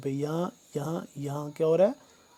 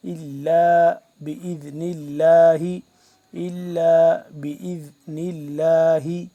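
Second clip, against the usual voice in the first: slow, drawn-out tajweed chanting; measured Quranic recitation; 9 dB louder, slower than usual. Shown as a demonstration. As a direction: recite each word with long held vowels. Teacher recites Quran verse.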